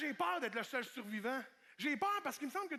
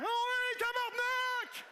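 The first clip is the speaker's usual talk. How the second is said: high, loud, slow swear; hammed-up crossing guard; 12 semitones higher, 5 dB louder, much slower than usual. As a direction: belt it out like a guard. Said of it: "un brigadier faire". (juron aigu, fort et lent)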